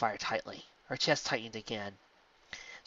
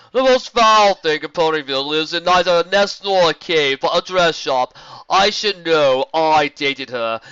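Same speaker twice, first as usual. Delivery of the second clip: boastful joker; 5 semitones higher, 13 dB louder, slower than usual. Acted. Pony telling a joke loudly.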